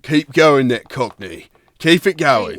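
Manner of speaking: lively Cockney, pushed a little; Cockney accent. Shown as a demonstration. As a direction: slip into Cockney to cheer them on. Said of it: "you started strong with the Cockney".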